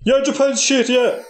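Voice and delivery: hammed-up Chinese accent; mock Chinese accent. Captor coaching hostage to insult Japan, mock Chinese accent. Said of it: "these fucking voices are more".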